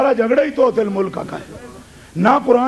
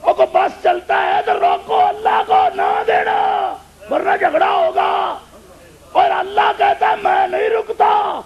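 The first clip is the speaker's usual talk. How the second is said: fiery preaching; 7 semitones higher, slower than usual. Acted. Preacher shouting at the congregation in full force. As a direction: hammer it home to the crowd.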